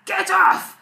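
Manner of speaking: high, loud, gruff little shout; gruff toddler shout. Retold or imitated. Toddler ordering someone away, gruff shout. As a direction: bark it like a tiny boss. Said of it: "She says".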